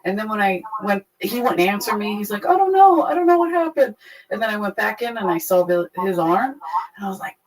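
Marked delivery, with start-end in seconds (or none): 2.5-3.9 panicky guy. Retold or imitated. Guy's panicked denial retold.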